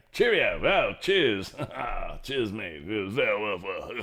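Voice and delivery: hammed-up British chap with swooping pitch; mock British. Mock British farewell to Britain.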